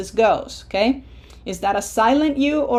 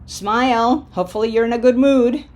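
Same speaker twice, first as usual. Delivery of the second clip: normal talking voice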